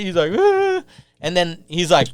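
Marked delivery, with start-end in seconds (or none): 0.4-0.8 high-pitched imitated wail; mock crying. Imitating the kid crying in the cell, mock wail. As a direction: let out a mocking high sob-wail. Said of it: "this dude's crying and shit".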